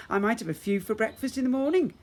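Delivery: loud, high grinning threat; menacing granny. Acted. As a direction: tease like a hungry old witch. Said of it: "with a crooked smile".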